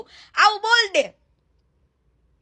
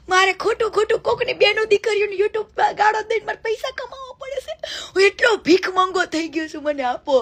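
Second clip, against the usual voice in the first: mock beggar crying; 3 semitones higher than usual. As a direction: beg like a starving man in a hammed-up sob. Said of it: "આખા સમાજમાં વાટકો લઈને જા કે". (હાસ્યજનક રડતો ભિખારી અવાજ)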